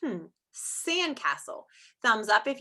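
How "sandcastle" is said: "sandcastle" slowly with a long S; emphasized S sound. Shown as a demonstration. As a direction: stretch the first S of "sandcastle". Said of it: "do you hear the S sound".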